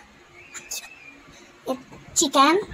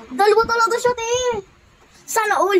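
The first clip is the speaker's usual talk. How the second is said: excited; 7 semitones higher, 4 dB louder than usual. Real, not acted. Delighted at finding two.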